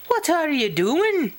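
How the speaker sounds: high-pitched, alarmed fairy call; sharp questioning fairy cry. Acted. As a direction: call across the room in a high voice. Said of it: "called Pish".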